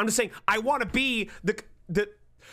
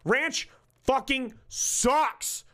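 slow, pitched-up, every word leaned on; drawn-out disgust; 4 semitones higher, much slower than usual. Real, not acted. Streamer declaring his hatred of ranch to chat, slow emphatic scorn. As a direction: drag out the contempt for ranch. Condiment hate rant.

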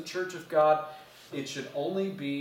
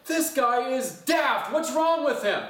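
high, slow, disbelieving mock complaint; exasperated nephew; 11 semitones higher, 5 dB louder, slower than usual. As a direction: drag out "daft" like you can't believe him. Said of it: "he's like".

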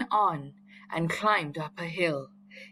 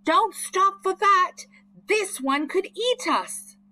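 high, loud urgent yell; boy warning his sister, high yell; 14 semitones higher, 6 dB louder than usual. A narrator's reading. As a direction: shout a warning to keep running. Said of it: "yelled Chip".

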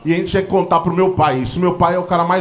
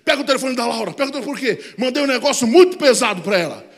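imitating a frantic phone call, pitched high; desperate father; 6 semitones higher than usual. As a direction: mock-desperate plea, raised pitch. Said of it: "ele me ligou desesperado".

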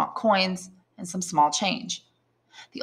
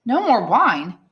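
higher, louder, alarmed echo; exclaimed disbelief; 5 semitones higher, 5 dB louder, slower than usual. Acted. Son shocked that father has no wine, alarmed exclamation. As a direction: exclaim it with a swooping stress on MORE. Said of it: "exclaimed Edmund, turning pale".

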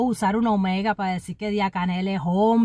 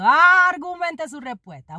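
louder, higher, schoolmarm order; mock teacher; 7 semitones higher, 4 dB louder than usual. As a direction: bark it like an exam prompt. Mock teacher exam demand. (como maestra exigente, más alto y agudo)

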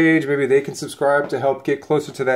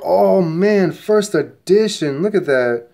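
first edition, slow and high with delight; excited; 5 semitones higher, 3 dB louder, slower than usual. Real, not acted. Collector thrilled by a first edition card, excited. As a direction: savor the find, voice lifting.

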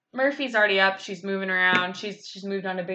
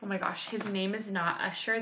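ordinary delivery

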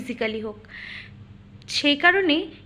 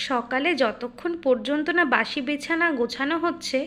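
ordinary talk

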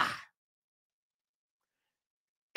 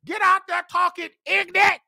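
normal talking voice

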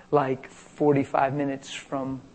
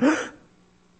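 sharp mock gasp of shock; imitated gasp; 13 semitones higher than usual. Girl feeling her jaw break, imitated gasp. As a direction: catch the breath, imitating a stunned girl. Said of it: "And she went".

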